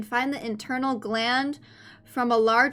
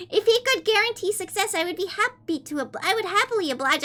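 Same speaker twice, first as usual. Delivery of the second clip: put-on high, sweet anime-girl reading voice; fake cute voice; 7 semitones higher than usual. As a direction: read it cutesy and high-pitched. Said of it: "I'll read the first page in a kawaii voice".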